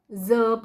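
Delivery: ज as "zə"; buzzed z for ज; z sound. Shown as a demonstration. Teacher tells students what they are reading, ज as z. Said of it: "ज पढ़ रहे हो".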